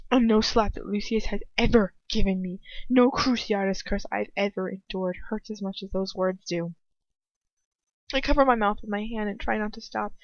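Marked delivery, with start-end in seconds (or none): none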